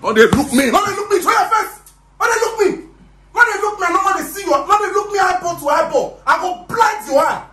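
high, shouted commands; furious shout. Acted. Boss yelling at the boy not to look at him, furious. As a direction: yell at him to stop looking at you.

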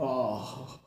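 long, soft falling groan; drawn-out weary "aah"; exasperated groan. Retold or imitated. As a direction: groan at the memory of mosquitoes. Groans recalling the mosquitoes.

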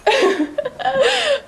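high bursting giggle. Real, not acted. Giggle after partner's line.

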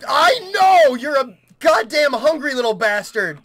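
very high, loud outburst; exasperated yell. Real, not acted. Player snapping at hungry game creature, exasperated yell. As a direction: yell in fed-up frustration.

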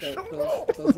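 laughing squeal. Real, not acted. Gamer laughing with his friends about the locker moment. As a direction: crack up mid-word.

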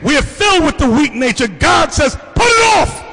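preaching. Real, not acted. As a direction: preach it with fire. Preaching at full force.

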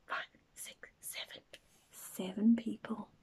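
a secret little count, barely voiced; hushed whisper. Storyteller counting boats with the children, whispering. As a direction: whisper the count softly together.

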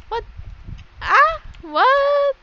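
high, startled exclamation; startled disbelief. Real, not acted. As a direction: squeal it in sudden disbelief. Sudden puzzled reaction in game.

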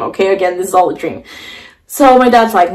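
ordinary talk, nothing marked

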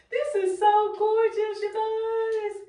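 high, breathy gush; high thrilled voice dropping to a hush; gushing delight. Real, not acted. Gushing over her shower.

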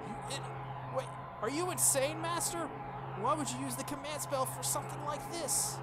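high, stunned protest; shocked servant. Acted. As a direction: react in disbelief.

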